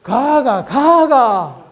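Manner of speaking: 가가 가가 loud and high, last 가가 drawn out; Gyeongsang tone demo. Shown as a demonstration. Lecturer demonstrates four-syllable 가 tones, loud and high. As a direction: belt the tones out clearly. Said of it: "요거 경상도 사람들만 할 수 있어요".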